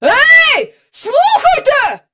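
high, loud drawn-out shout; outraged high-pitched yell; hollering. A narrator's reading. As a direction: holler up at someone in the sky. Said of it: "заголосував він".

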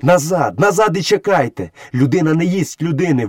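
high, urgent cry without much volume; exclaimed command. A narrator's reading. Mowgli stops wolves hunting a man, crying out. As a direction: order them back in a high-pitched cry. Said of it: "викрикнув Мауглі".

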